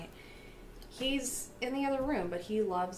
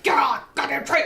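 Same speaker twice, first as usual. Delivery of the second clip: loud, higher mock yell; imitated grandpa yelling; 4 semitones higher, 8 dB louder than usual. Granddaughter imitating grandpa yelling at her in the tree, mock shout. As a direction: yell it like an angry old grandpa. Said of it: "started yelling at me".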